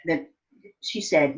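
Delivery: usual speaking voice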